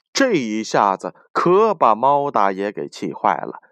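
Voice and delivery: ordinary delivery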